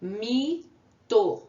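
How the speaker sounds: Mito split into Mi, then to; syllable by syllable. Shown as a demonstration. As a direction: stretch Mi, pause, then to. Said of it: "Duas sílabas".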